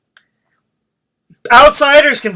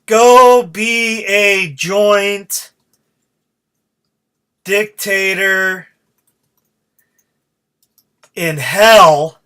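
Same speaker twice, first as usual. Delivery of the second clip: drawn out, word by word, as if typing; slow dictation; much slower than usual. Shown as a demonstration. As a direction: spell the tweet out slowly while writing it. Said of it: "so far I have written".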